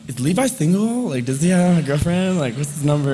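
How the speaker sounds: put-on a smitten teenager asking breathlessly about her crush; gushing girl.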